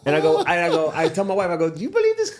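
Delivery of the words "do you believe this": high-pitched, comic indignation; retold grumble, hammed-up and high; indignant griping. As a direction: rant in disbelief, pitched way up. Retold rant to his wife.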